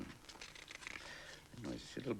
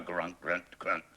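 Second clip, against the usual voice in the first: louder grunting; pig grunts; 3 semitones higher, 5 dB louder, slower than usual. Acted. Pig in the mud, grunting. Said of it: "thought the pig".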